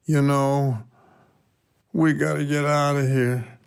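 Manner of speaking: a mild, weary moan with pauses. A narrator's reading. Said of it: "moaned at exactly the same time".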